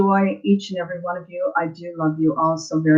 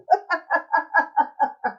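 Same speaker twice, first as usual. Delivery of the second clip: high, self-conscious laugh trailing off; shy laugh; 10 semitones higher, 4 dB quieter than usual. Real, not acted. Asking viewers for requests, a bashful laugh. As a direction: laugh at her own nerves.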